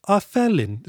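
high-pitched attempt at a British accent; mock British accent. Critic mocking the officer's wasted line, mock British accent. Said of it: "I don't know what accent that was".

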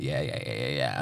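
low, soft, lazy string of yeahs; mock nonchalant yeahs. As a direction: play it cool and offhand. Voicing self casually.

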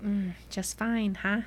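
slow, soft teasing pout. Retold or imitated. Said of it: "just trying to get him to say something".

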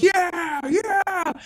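very high mock crowd cheer; cheering spectators. Game master voicing people watching the fight, high cheers. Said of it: "people are sitting and watching. They're like".

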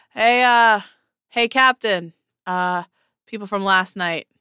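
calls loudly down the hall; yelling back. Acted. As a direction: shout it back toward the offices. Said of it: "And he yells back".

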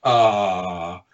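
long, drawn-out letdown "ah"; groaned "ah". Retold or imitated. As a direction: groan it like a crowd when a life is lost. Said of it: "hear a bunch of people going".

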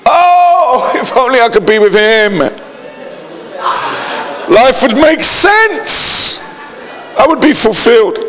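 lightly hammed-up lovelorn sighing, high at the peaks; mock longing. Preacher voicing someone pining for a dream partner, mock longing.

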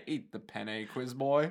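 low, very soft command; low mock-threatening insistence; hushed menace. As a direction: order it quietly, barely above a murmur. Acted exchange, insisting.

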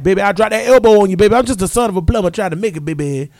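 fast, loud drawling wrestler promo; imitating Dusty Rhodes. Host imitating Dusty Rhodes on the mic, hammed-up promo. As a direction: ham up the old wrestler's promo. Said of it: "Dusty was like".

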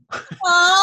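bursts into a big high laugh; real burst of laughter at the memory; loud laugh. Real, not acted. Telling a friend about hugging the accountant, loud laugh.

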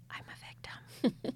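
mocking whisper, fading out. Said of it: "Other than".

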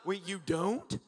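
incredulous questions with big rising ends; exaggerated disbelief, swooping up at the end; mock shocked. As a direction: act flabbergasted at their ignorance. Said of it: "you're shocked, you know".

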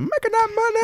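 high silly voice; sing-song echo. Pretend. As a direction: squeak it out playfully. Echoing money line in silly voice.

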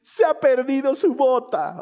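high, slow mock-tearful child; hammed-up child's lament; crying little girl. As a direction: wail it like an upset little kid. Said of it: "las tengo a dos enanas llorando".

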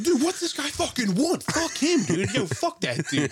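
high-pitched exasperated rant; mock indignation. Driver to friends about a beeping stranger, mock indignant. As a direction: squeal it in worked-up disbelief.

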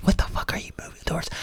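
hushed, breathy aside; sudden whisper. Real, not acted. Coach whispering to viewers about vague goals, hushed. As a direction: drop to a whisper to make the point.

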